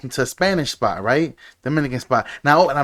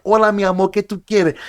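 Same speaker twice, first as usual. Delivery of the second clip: Spanish-speaking waitress; 5 semitones higher than usual. Retold or imitated. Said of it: "Dominican spot".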